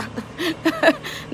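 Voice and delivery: soft, high giggle; laughing. Real, not acted. Still amused by her hospital joke, soft high giggling. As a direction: giggle lightly in a short run.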